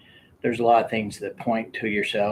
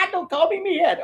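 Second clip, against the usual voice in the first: laughing mock threat; 4 dB louder than usual. Kid threatens while fighting.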